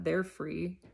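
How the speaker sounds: ordinary delivery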